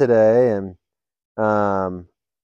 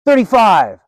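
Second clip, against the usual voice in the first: louder, higher call across the room; prisoner yelling; 9 semitones higher, 7 dB louder, slower than usual. Retold or imitated. Prisoner calls a joke number across the visiting room, yelling. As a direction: shout the number out like a punchline. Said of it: "one of the prisoners yells".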